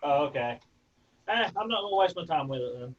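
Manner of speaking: ordinary delivery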